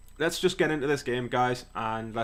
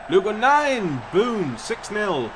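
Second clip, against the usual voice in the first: higher, loud celebration; excited goal call; 7 semitones higher, 5 dB louder, much slower than usual. Real, not acted. Calling the goal to viewers, excited. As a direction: shout it as it goes in.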